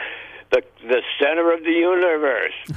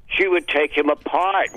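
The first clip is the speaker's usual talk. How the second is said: normal talking voice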